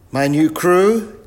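slow, loud, high query; puzzled professor. Acted. Professor mistakes the children for crew, loud and puzzled. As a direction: ask it loudly, drawing it out.

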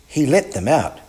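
plain reading voice

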